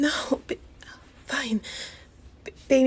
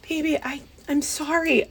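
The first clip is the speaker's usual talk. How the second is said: ordinary talk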